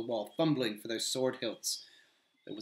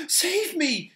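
much higher, louder yelp of dismay; dismayed cry; 8 dB louder than usual. Acted. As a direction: yelp at his own blunder. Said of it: "said Sam".

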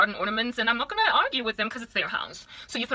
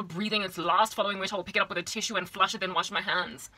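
normal talking voice

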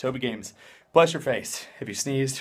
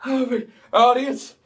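mock grand address; 10 semitones higher, 6 dB louder, slower than usual. Greeting viewers in mock grandeur.

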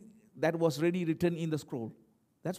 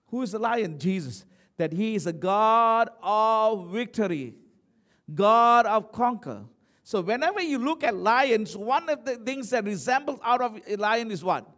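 triumphant preaching; 5 semitones higher, 6 dB louder than usual. Real, not acted. Preacher proclaims God of victory to congregation, loud and forceful. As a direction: build a sermon climax, ringing out.

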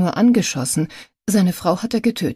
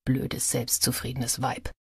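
inner thought; 5 semitones lower, 9 dB quieter than usual. A narrator's reading. Reporter's inner contempt.

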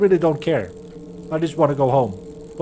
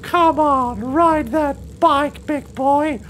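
higher, drawn-out goofy cheer; silly cheering voice; 6 semitones higher, slower than usual. Pretend. Player cheering himself on the bike in a goofy voice. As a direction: egg the bike on like a coach to a pet. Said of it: "Why am I talking like this?".

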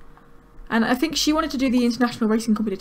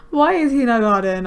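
usual speaking voice

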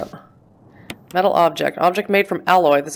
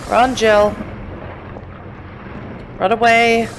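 urgent cheering; 5 semitones higher than usual. Real, not acted. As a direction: push the character to run, tense. Urging character while fleeing.

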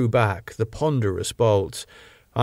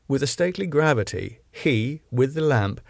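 ordinary delivery

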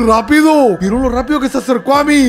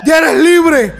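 normal talking voice

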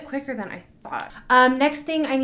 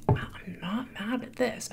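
whispering; 7 dB quieter, slower than usual. Real, not acted. Relieved after pull.

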